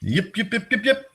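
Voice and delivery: high yapping little dog; high dog yips; yipping dog. Pretend. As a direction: yip like a tiny excited dog.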